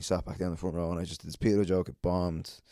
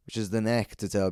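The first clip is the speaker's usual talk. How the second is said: ordinary talk, nothing marked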